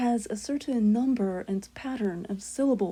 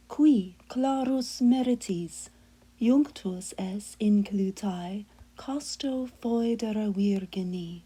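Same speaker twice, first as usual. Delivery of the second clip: prayerful Latin chant, unhurried; Latin hymn recitation; slower than usual. Acted. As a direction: intone the close of the verse like evening prayer.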